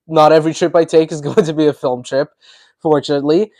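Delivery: ordinary talk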